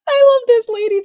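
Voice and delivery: high, loud, squealing gush; delighted squeal. Real, not acted. Viewer gushing over a lady in a video, delighted squeal.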